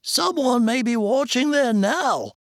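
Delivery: high, agitated man's voice; alarmed character voice. A narrator's reading. Isaac warning his companion of a watcher, alarmed. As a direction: keep it pitched high with alarm.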